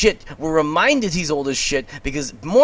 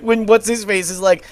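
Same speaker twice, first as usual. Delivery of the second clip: ordinary delivery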